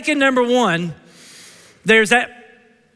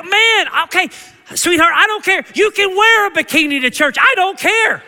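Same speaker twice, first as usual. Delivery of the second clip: exasperated giving up; 10 semitones higher, 4 dB louder than usual. Retold or imitated. Worn-down parent giving in to kids.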